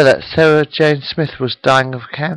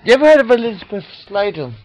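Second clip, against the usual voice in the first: sudden excited question; 8 semitones higher, much faster than usual. Real, not acted. Abrupt high quick question.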